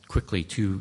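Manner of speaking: usual speaking voice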